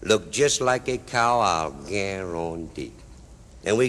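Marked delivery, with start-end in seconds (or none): 1.4-2.9 very slow, low sign-off; drawn-out catchphrase. Acted. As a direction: stretch it out and let it sink. Catchphrase capping the cow disguise.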